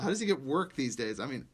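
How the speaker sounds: usual speaking voice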